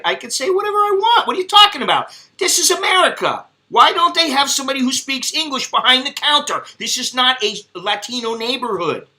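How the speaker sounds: hammed-up outrage building then fading; retold rant. Son ranting at his mother about the counter, shrill.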